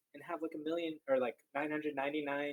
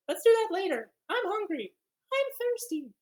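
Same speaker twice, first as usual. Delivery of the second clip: put-on much higher, louder whiny child voice; whiny kid; 7 dB louder than usual. Teacher mimicking a restless kid who wants to stop, high and whiny. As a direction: play a restless kid begging to quit.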